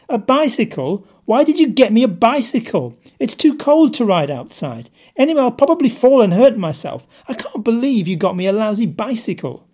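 high, loud, whining outburst; complaining cry.

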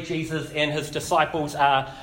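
ordinary talk

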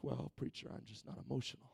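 hammed-up bored murmur; mock dull mumble. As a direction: deflate into a lifeless churchgoer. Fans turn dull at church.

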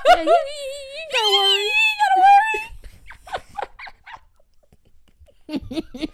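squeaky-high mock Brahms, breaking into laughter; voice going up. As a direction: climb into a laughing, absurdly high reassurance. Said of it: "why his voice going up like that".

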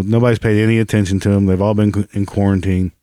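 ordinary delivery

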